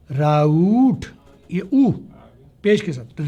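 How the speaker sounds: ordinary delivery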